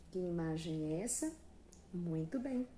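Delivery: ordinary talk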